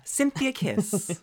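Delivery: high, slow, drawn-out diva name; drag queen intro. Acted. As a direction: announce yourself like a diva. Dramatic self-introduction with kiss.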